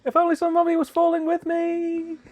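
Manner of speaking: high, loud mock lament; high mock wail. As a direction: wail it like a falling henchman. Mock falling henchman lament.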